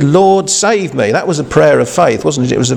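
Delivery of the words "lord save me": slow, high plea for help; a touch of a sinking man's plea; urgent cry. Retold or imitated. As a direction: cry out short and to the point. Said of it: "But he said".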